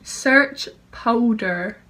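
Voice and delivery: slow, careful command; repeating to voice assistant. Real, not acted. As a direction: spell it out slowly for the assistant. Repeats after misheard command.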